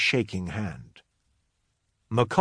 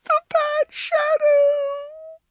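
very high, drawn-out tearful wail; wailing child; 4 dB louder, much slower than usual. A narrator's reading. Crying girl frightened of the shadow, wailing. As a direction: wail slowly like a sobbing little girl. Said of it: "Yonsei wailed".

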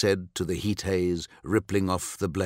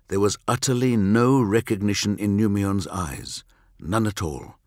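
usual speaking voice

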